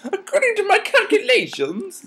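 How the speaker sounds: very high, squeaky nerd parody. Shown as a demonstration. Demonstrating a Twilight voice for co-reader, nerd parody. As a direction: push it way up into a mock nerd squeak. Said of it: "Sound like a nerd".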